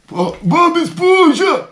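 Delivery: high, slow cartoon yell; Patrick. Podcaster retrying a Patrick imitation, high slow cartoon yell. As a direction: stretch the name out in a high goofy cry. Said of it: "uma imitação do Patrick".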